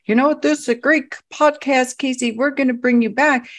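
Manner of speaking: high, bright promising host voice; mock podcast host. Podcast host promising a guest's return, mock cheerful.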